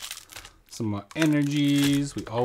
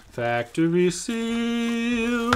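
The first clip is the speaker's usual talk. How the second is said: announcer voice; 11 semitones higher, 3 dB louder, much slower than usual. Pretend. Dad jokes like a product ad.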